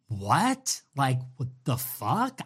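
quiet, rising disbelief; genuinely lost, half to himself; baffled. Real, not acted. Baffled by Tucker's logic.

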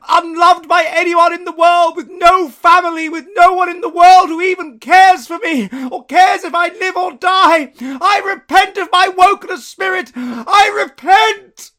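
high, loud, slow desperate shouting; Scrooge's anguished shout. Acted. Scrooge repenting before the spirit, desperate shout.

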